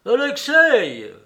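loud, drawn-out call from afar. A narrator's reading. Father calling his son from afar, loud. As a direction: shout the name across the yard. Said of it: "cria de loin".

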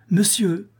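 urgent shout of monsieur to wake him. Acted. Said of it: "s'écria la jeune femme en lui frappant sur l'épaule".

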